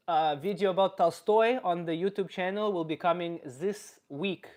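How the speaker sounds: put-on comic Russian character accent; Russian-accented comedy persona. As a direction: speak English with a thick Russian accent. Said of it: "I'm gonna stop doing the accent".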